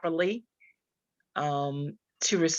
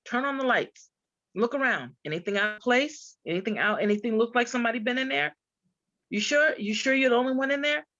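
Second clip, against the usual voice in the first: higher, urgent checking questions; insistent protective friend; 5 semitones higher than usual. Retold or imitated. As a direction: press her to check the house, pitched up. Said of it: "He said".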